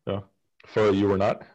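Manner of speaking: ordinary talk, nothing marked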